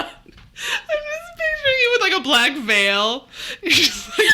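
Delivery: high, breathless laughter; laughing hard. Real, not acted. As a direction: crack up mid-sentence. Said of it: "I'm laughing so hard".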